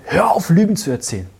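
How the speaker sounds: a touch of shouting at a false Jesus; retold angry shout. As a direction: snap back at him, lightly acted. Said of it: "habe ihn angeschrien im Traum".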